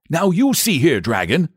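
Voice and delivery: high, firm telling-off; acted stern rebuke; firm saint. A narrator's reading. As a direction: cut in and take the dragon to task. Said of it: "said the saint firmly".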